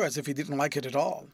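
usual speaking voice

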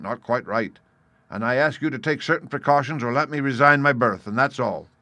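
loud, emphatic sea captain; firm formal protest from an officer; blunt captain, standing firm. Acted. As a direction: insist forcefully on your responsibility. Captain presses his demands firmly.

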